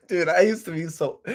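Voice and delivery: breaking into a laugh while talking. Real, not acted. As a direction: say it through amused laughter. Amused at old self in chat.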